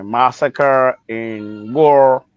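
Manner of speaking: usual speaking voice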